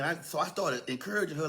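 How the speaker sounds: ordinary delivery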